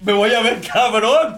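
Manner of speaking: laughing mock brag. Mock bragging quote, laughing.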